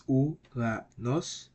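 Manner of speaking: "Uranos" slowly split as u, ra, nos; syllable by syllable. Shown as a demonstration. Teacher breaking the word down, "Uranos" slowly in separate syllables. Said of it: "break it down into syllables".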